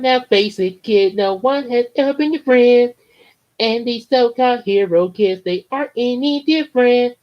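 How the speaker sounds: high, loud taunting bully; Casey voice. Acted. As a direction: sneer it out like a smug kid. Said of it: "so Casey from Pokemon".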